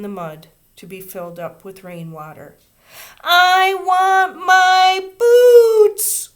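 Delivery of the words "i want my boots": very high, very loud, very slow howl. A narrator's reading. Little girl howling to her teacher, crying. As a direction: howl it with tears. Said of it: "she howled".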